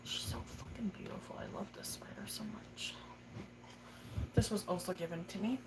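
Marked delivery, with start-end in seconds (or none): 0.0-1.4 hushed, breathy awe over her pet; whisper. Real, not acted. Owner whispering admiringly over her tarantula, hushed. 1.4-2.9 soft whispered adoration. Real, not acted. Owner whispering to her tarantula, soft whisper.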